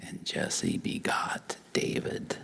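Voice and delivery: dropped to a whisper, slow; hushed reveal. Real, not acted. Preacher reaching David in the genealogy, slow hushed whisper. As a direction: whisper the name like a secret.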